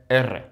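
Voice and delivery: Spanish letter name "R" as EH-rreh, trilled; rolled double R. Shown as a demonstration. Naming the double R spelling, trilled "R". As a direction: roll "R" as erre. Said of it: "here is the double R spelling".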